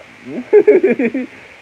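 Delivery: loud high burst of laughter. Real, not acted. Vlogger laughing at the fake claim, loud laugh. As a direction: let out a big laugh.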